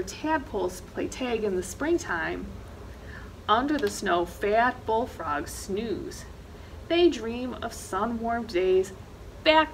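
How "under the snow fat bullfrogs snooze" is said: usual speaking voice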